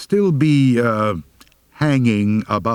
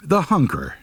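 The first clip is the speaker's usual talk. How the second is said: plain reading voice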